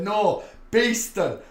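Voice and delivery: hammed-up Leeds accent, stretched slowly; thick Leeds accent. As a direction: imitate a broad Leeds girl correcting you. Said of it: "her accent was so thick from Leeds".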